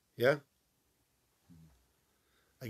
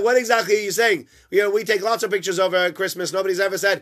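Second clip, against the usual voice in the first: indignant protest; 7 semitones higher, 11 dB louder, faster than usual. Retold or imitated. Stranger protests his excuse.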